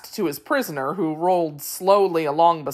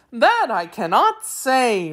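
slow, loud, swooping high voice; Wheeler creature; 6 semitones higher, 3 dB louder than usual. A narrator's reading. Wheeler answering the machine man, high singsong creature voice.

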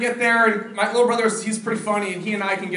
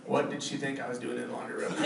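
worried inner thought; 9 semitones lower, 13 dB quieter than usual. Retold or imitated. Late-night worried thought.

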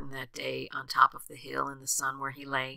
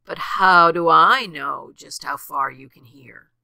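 loud, mildly sneering question; sneering challenge; 11 dB louder than usual. A narrator's reading. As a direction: challenge it with emphatic doubt. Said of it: "said the bear with a sneer".

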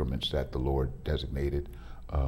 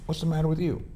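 a hint of the wife's irritation, pitched higher; wife's irritated question; 10 semitones higher, faster than usual. Wife confronting husband who came home scowling, mildly irritated. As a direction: ask it like a mildly annoyed wife.

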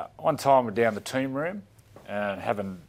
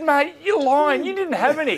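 mock teammate accusing; 8 dB louder than usual. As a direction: call out the fib loudly. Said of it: "Bedsy said".

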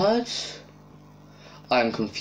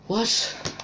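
surprised; 11 semitones higher than usual. Real, not acted. Confused shock in game.

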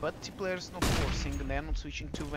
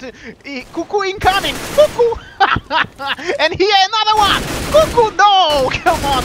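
excited shouting; 9 semitones higher, 14 dB louder than usual. Real, not acted. Caster hyping a kill streak.

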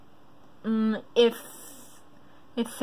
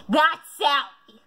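sudden, higher, drawn-out exclamation; loud outburst; 4 semitones higher than usual. Real, not acted. Quiz taker recognises Sally's story, loud outburst. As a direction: blurt it out too loud. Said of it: "that was loud".

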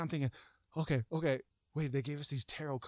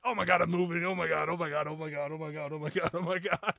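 breathless run of repeated panic; mock panic; 3 semitones higher, 3 dB louder than usual. Man frozen in terror as hands start shaking, panicked babble. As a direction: rattle it off like a panicking loop.

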